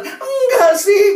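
very high, drawn-out wail; mock sobbing child. Weeping pupil denies teacher's guess, mock sobbing. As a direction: wail it out slowly and loudly. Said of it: "dia nangis terseduh-seduh".

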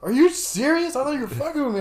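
high, loud-starting incredulity; acted-out disbelief. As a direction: imitate a friend who can't believe it, voice way up.

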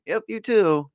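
chirpy goodbye. Retold or imitated. Playing himself saying bye to the girl, high and bright. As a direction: toss it off brightly.

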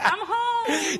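high, loud, laughing; delighted sigh of home. Retold or imitated. Driving onto the Mass Pike, delighted laughing. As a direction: beam it out like you've arrived. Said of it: "I'm like".

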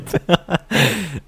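loud high laugh at himself; bursting laugh. Real, not acted. Laughing at his own boast.

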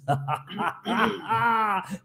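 soft, low villain chuckle; a sinister, quiet cackle; evil laugh. Acted. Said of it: "me gostaram da minha risada maligna?". (risada maligna baixinha)

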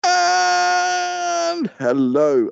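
long drawn-out, high shouted intro; show-host announcement. Pretend. Host opens the question and answer section, grand announcement. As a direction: stretch it out loud like a big presenter.

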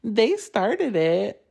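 put-on high, drawn-out kid voice; mimicked childish tattling; mock childish protest. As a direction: imitate a child blaming others, slow and high. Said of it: "she sounds like a child".

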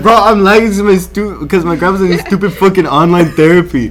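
heated mocking quote of a friend; mock irritated complaint. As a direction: imitate a friend griping mid-game. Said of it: "He was like".

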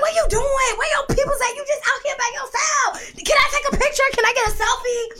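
very high, loud rapid questions; fangirl questions. Retold or imitated. Asking Andre for a selfie, high and excited. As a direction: pepper the star with questions.

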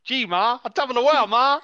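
high, loud hammed-up American accent; bad American accent. Co-host joins in mocking the actor, bad American accent. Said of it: "never heard an American accent before".

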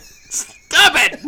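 high, loud cry to stop; scandalized protest. Real, not acted. Co-host protests host's dirty joke, scandalized shout. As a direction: shout it down, pitch shooting up.

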